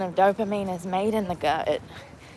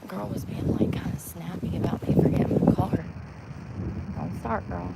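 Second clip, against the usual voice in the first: whispering; 3 dB quieter than usual. Real, not acted. Gossiping under breath.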